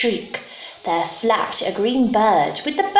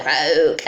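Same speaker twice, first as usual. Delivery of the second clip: frog croak; 5 semitones lower than usual. Acted. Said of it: "its terrible voice".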